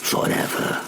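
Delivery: usual speaking voice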